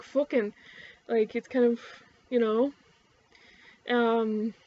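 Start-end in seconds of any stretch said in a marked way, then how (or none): 0.0-0.5 "fuckin'" with a rounded OO, Newfoundland style; Newfoundland and Maritime OO in "fuckin'"; Newfie Maritimer accent. Shown as a demonstration. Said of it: "Newfie Maritimers are more like".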